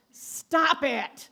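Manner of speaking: loud, high-pitched "stop it"; comic scolding shout. Acted. Preacher cutting off an imagined know-it-all, comic scolding shout. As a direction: cut them off with a mock-stern yell.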